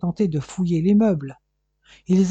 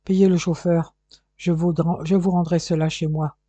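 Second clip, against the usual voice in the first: usual speaking voice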